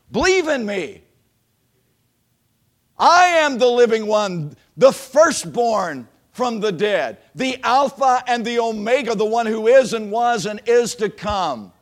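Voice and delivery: loud, slow, proclaiming pulpit voice; preaching at full force. Acted. Preacher voicing Jesus to the congregation, proclaiming loudly. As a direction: proclaim it as the voice of the risen Lord.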